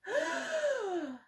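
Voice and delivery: big nervous gulp of air before flying; a baby owl gasps as he braces to fly down; deep breath in. Acted. Sharp gasp in. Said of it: "took a deep breath".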